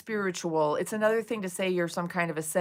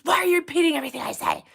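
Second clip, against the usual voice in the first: fast, higher, louder imitation of an angry streamer; mock angry rant; 8 semitones higher, 6 dB louder, much faster than usual. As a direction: rattle it off like an irritated guru. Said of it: "And he goes".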